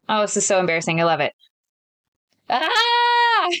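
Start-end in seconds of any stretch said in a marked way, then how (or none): 2.5-3.5 high yell with a voice crack; rage yell. Pretend. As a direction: let rip a rage scream. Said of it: "Let me try one".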